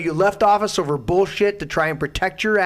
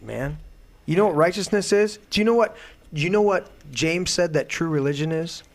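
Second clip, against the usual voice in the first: usual speaking voice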